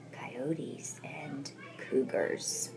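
hushed dramatic whisper, slowing down. Acted. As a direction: breathe the dangerous names out. Lizard lists dangers, hushed.

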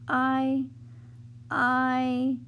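halting nervous stammer. A narrator's reading. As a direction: trip over the first word. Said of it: "stammered Mona".

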